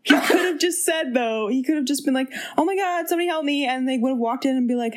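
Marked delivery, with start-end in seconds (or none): 2.6-3.7 higher-pitched pretend call for help; mock plea for help. Host imagines him calling for help, mock plea.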